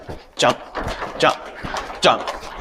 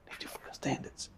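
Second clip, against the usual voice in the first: whispered urge; 25 dB quieter than usual. Real, not acted. Urging teammates softly.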